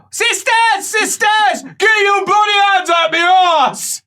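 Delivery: very high, loud holler; acted frantic yell. Patient calling the sisters to stop, acted frantic shout.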